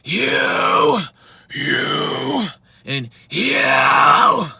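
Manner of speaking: raspy screams stepping between registers; low, mid and high screams. Shown as a demonstration. Coach comparing the three registers, contrasted screams. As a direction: scream each one in a different register. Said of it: "low, mid, high".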